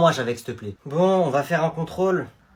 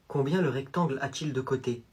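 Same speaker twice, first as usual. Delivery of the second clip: normal talking voice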